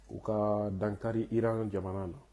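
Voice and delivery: ordinary talk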